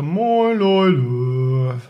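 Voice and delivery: Moin high, Leute dropped low; high to low greeting. Shown as a demonstration. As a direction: swoop from top to bottom. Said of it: "von oben nach unten und so".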